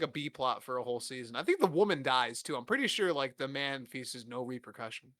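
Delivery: normal talking voice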